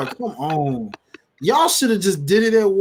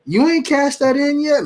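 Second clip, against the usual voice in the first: high, loud disbelieving question; pitched-up, amazed self-quote; incredulous reaction; 11 semitones higher, 5 dB louder than usual. Retold or imitated. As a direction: blurt it out high in mock astonishment. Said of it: "and I'm like".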